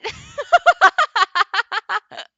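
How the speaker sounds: long, high staccato laugh; loud high cackle. Acted. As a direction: burst into a rapid, loud cackle. Big laugh after outburst.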